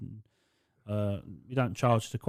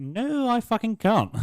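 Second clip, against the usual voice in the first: mock shout; 11 semitones higher, 5 dB louder, slower than usual. Exaggerated refusal.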